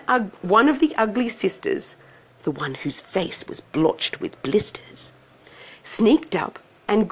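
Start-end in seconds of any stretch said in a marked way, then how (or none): 2.4-5.1 conspiratorial whisper; whispered aside. A narrator's reading. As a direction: whisper it like gossip. Narrator aside about sister.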